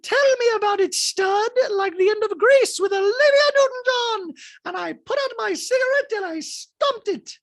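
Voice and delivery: very high, loud hammed-up caricature; Dorit. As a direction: shriek it in a piercing put-on voice. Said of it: "And Dorit's like".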